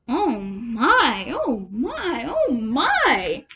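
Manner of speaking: sing-song amazed rabbit chorus; rabbits marvelling. A narrator's reading. Roadside rabbits admiring the cart, sing-song wonder. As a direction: gasp it out like delighted rabbits. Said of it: "held up their forepaws and said".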